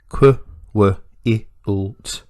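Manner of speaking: qu, w, i, u, t each sounded separately; segmented. Shown as a demonstration. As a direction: sound out quilt with gaps between phonemes. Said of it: "break them up into their sounds".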